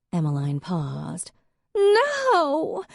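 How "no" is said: high, loud shriek. A narrator's reading. Jealous ex-wife guessing the answer, a high loud shriek. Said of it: "Hadley shrieked".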